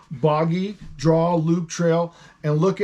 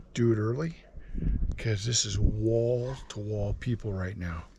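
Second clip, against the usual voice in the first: hushed, lower and softer; whispering; 6 semitones lower, 7 dB quieter than usual. Real, not acted. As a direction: whisper so the crowd won't hear. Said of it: "Why are we whispering?".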